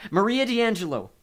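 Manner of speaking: high, loud, commanding call; summoning call. A narrator's reading. Nico summons his dead mother, calling out. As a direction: call out to raise a spirit.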